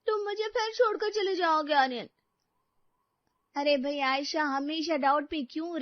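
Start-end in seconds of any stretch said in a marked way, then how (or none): 0.1-2.1 filmy heroine. Acted. Voicing movie heroine on TV.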